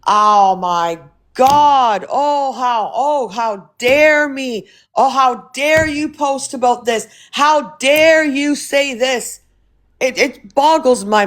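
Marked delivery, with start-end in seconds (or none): 0.1-9.4 drawn-out, slow mock indignation; mock outrage. Streamer mimicking critics offended by her opinion, mock outrage. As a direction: stretch out every word in exaggerated horror.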